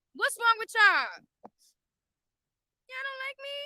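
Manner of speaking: mock hurt.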